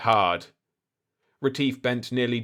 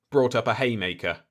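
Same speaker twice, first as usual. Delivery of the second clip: ordinary delivery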